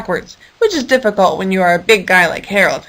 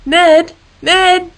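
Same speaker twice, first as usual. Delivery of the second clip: higher, louder calling Ned; 8 semitones higher, 3 dB louder than usual. Acted. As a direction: call up to the wire. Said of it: "called out to him".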